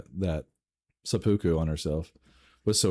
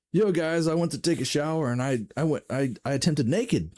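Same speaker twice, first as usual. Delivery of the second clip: goofy bro voice; 7 semitones higher than usual. Pretend. Jokey future use of phrase.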